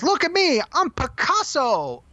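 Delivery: high, excited toy character voice; imitating Mister Potato Head. Retelling a Toy Story scene to Chris, high character impression. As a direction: crow it high, drawing out the name. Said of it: "he turns and he says".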